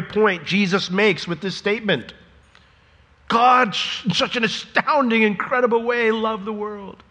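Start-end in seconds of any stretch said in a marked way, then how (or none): 3.3-7.0 "astounding, incredible" held high and insistent; emphatic. Shown as a demonstration. Preacher showing the force of "so loved", high and emphatic. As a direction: lift "God, in such an astounding, incredible way" high, easing off on "world". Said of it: "we have to make it emphatic".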